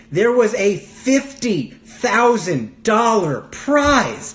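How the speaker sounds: FIFTY THOUSAND DOLLAR PRIZE slow, high, word by word; drawn-out emphasis. Acted. Host reveals the prize, "fifty thousand dollar prize" slowly punched. Mock amazement at huge prize money.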